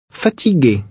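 normal talking voice

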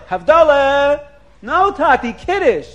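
retold astonished objection; incredulous protest. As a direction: correct Dad in mock dismay. Child protests to mistaken father.

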